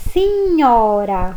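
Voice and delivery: "signora" drawn out, gn like nh; slow signora. Shown as a demonstration. Teacher showing gn sounds like Portuguese nh. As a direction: stretch the gn of "signora". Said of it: "Olha o G N aí, com som de N H".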